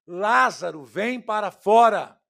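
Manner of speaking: shouted order, slow and forceful; loud commanding shout. Retold or imitated. Preacher voices Jesus ordering Lazarus out of the tomb, loud shout. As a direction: call the dead man out with a loud command. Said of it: "ao gritar".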